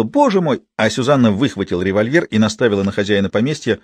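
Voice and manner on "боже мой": sudden high frightened squeal; shriek of fright. A narrator's reading. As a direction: yelp it out in alarm. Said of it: "Ида взвизгнула".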